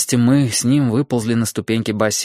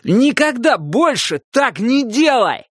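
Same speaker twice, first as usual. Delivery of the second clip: yelling; 11 semitones higher, slower than usual. Acted. Still yelling at friend in pool.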